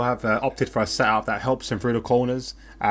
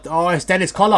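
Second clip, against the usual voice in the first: higher and louder, caught up in the moment; excited commentary; 7 semitones higher, 7 dB louder than usual. Real, not acted. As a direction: call the spin as it happens, voice lifted high. Spin on track, live reaction.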